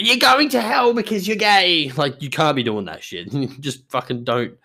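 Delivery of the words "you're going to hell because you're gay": high, slow, louder mock condemnation; mock preacher yell. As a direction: ham up a ranting street preacher. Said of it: "can't be screaming at people".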